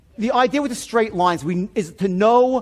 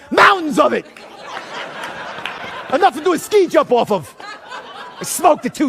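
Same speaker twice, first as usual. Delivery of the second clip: livid; 8 semitones higher than usual. Retold or imitated. Customer exaggerating cocaine use to the agent, livid. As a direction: hurl the confession out, hammed-up. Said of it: "and I'm livid, right?".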